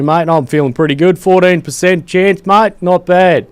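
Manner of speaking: loud, high, hammed-up Australian accent; full Aussie. To his viewers, hammed-up loud Australian accent. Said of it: "I'm going full Aussie again".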